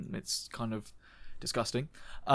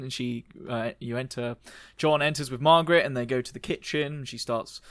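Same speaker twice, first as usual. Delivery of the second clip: usual speaking voice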